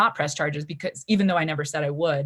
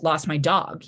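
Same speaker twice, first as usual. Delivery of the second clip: ordinary delivery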